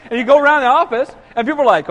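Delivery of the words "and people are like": ordinary delivery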